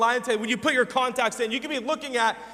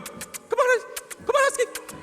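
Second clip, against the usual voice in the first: very high-pitched pet-coaxing voice; wife coaxing animal; 14 semitones higher than usual. Retold or imitated. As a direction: imitate a woman sweetly calling a dog, way up high. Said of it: "side of the road going".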